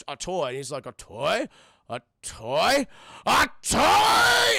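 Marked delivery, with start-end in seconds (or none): 0.9-2.9 incredulous question, swelling louder on the repeat; rising disbelief. Retold or imitated. Burgermeister told he stepped on a toy, mounting disbelief. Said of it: "he's not happy". 3.3-4.6 high, loud, drawn-out hammed-up yell; outraged shout. Burgermeister told he stepped on a toy, enraged shout. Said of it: "he's not happy".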